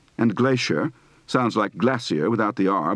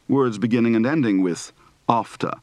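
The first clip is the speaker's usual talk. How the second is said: normal talking voice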